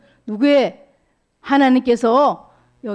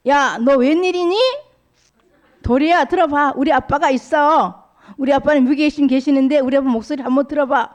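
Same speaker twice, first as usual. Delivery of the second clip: put-on proud excited child; 5 semitones higher than usual. Sora boasts to Dori.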